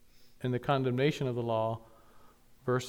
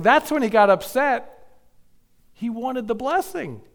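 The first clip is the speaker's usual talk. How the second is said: higher, louder impassioned preaching; a preacher pressing the lesson on the congregation; preaching at full force; 7 semitones higher, 6 dB louder than usual. Acted. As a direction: drive the warning home from the pulpit. Preaching climax on Esau.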